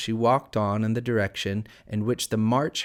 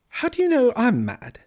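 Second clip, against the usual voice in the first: high, louder questioning voice; indignant Alice; 14 semitones higher, 5 dB louder than usual. A narrator's reading. Alice challenging the Cat, high and indignant. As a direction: pitch it right up in protest.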